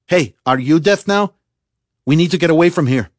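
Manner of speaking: high, loud shout to get attention; urgent shout. A narrator's reading. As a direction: shout to jolt someone out of a daydream. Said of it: "had to shout it".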